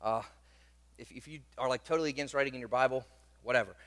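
usual speaking voice

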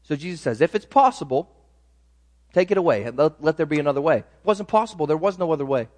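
normal talking voice

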